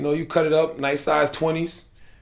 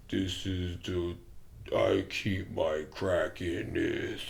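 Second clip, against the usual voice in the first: slow mumbling guy; 7 semitones lower, 10 dB quieter, much slower than usual. Retold or imitated. Imitating J J's explanation.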